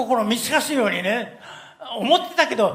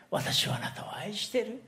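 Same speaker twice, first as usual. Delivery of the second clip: whispered verse; 6 dB quieter than usual. Acted. Preacher to the congregation, repeating God's promise of love in a whisper. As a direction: whisper it tenderly, unvoiced.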